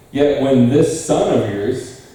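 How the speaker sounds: slowed, leaning hard on "son"; stress on son. Shown as a demonstration. Preacher reading the older brother's complaint to the church, weight on "son". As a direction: press the word "son" in "this son of yours". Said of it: "emphasis there".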